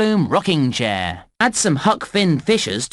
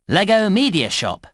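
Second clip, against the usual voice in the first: ordinary talk, nothing marked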